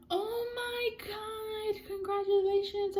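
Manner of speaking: high, drawn-out mock squeal; imitating gushing congratulations. She congratulates the girl on her period, mock gushing. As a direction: gush slowly in a put-on high voice.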